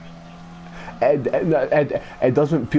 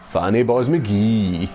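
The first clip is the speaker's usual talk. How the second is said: slow, drawn-out, lower-toned insult; insult demo; 3 semitones lower, much slower than usual. Shown as a demonstration. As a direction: stretch out "Fanny boz McGee" slowly. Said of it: "if you really want to insult them".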